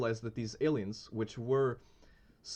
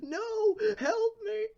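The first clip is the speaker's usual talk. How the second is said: very high, loud cry for help; mock scream; 6 dB louder, much slower than usual. Imitating the sacrificed friend carried into space, screaming. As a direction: squeal it like a victim being carried off. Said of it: "and him screaming".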